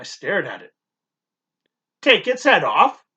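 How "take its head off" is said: a housekeeper instructing, higher and louder; firm instruction from the housekeeper. A narrator's reading. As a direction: housekeeper telling the boy how to eat the egg. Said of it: "she instructed".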